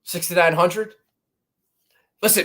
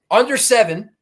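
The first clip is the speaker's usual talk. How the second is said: ordinary delivery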